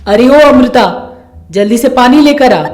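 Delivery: loud summons across the house; urgent, commanding call. Acted. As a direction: shout for someone to hurry over. Calls daughter-in-law for water.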